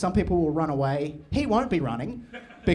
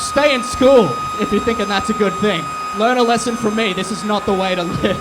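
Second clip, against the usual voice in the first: much louder, higher shouted patter; shouting over crowd; 6 semitones higher, 8 dB louder than usual. Real, not acted. Comic to crowd during chaos, loud high shouted patter. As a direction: project over the roaring crowd.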